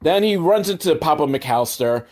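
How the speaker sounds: usual speaking voice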